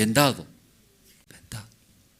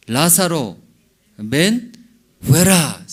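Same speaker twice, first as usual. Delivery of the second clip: calling loudly; 4 semitones higher, 5 dB louder, slower than usual. A narrator's reading. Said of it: "clamó a gran voz".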